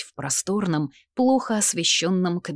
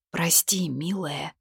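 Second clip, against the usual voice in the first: hushed, guilty whisper; slower than usual. Acted. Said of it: "unvoiced whisper".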